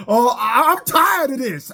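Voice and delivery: mock whiny high voice; mocking whine. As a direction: whine it like a pushover being mocked. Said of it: "like a bitch".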